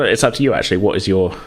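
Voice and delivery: ordinary talk, nothing marked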